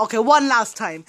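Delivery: ordinary delivery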